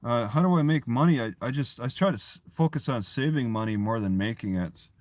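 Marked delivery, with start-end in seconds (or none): none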